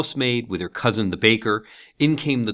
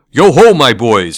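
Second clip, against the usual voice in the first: louder, hearty jolly hail; jovial Fezziwig; 4 semitones higher, 11 dB louder than usual. A narrator's reading. Fezziwig hailing his apprentices, hearty and loud. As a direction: call out like a merry old master. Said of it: "said Fezziwig".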